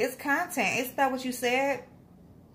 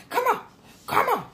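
calling the dog; 12 semitones higher, faster than usual. Retold or imitated. Still mocking Nicole, high coaxing dog call. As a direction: coax a dog to come, mocking her. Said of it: "Like you're calling the dog".

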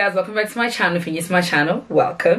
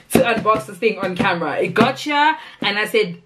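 ordinary delivery

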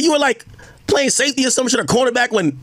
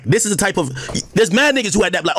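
ordinary talk, nothing marked

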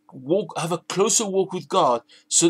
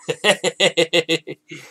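real chuckle after his own joke; laugh. Real, not acted. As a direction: laugh in a run of short bursts.

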